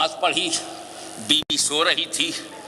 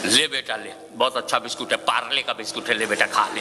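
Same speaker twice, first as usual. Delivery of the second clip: put-on coaxing baby; 6 semitones lower, faster than usual. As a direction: tempt the child with a biscuit. Father coaxing baby with biscuit. (धीमी, नरम आवाज़ में बच्चे को मनाना)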